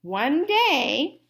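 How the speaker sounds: plain reading voice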